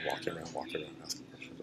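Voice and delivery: unvoiced murmur repeating instructions; soft whispered reminder to self. Real, not acted. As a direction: whisper it under your breath.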